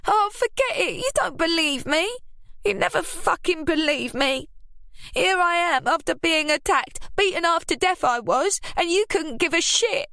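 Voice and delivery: high, rising self-pitying rant; losing her temper. A narrator's reading. Ruby ranting at Lily that nobody believes her. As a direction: let the grievance boil over. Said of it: "beginning to lose her temper".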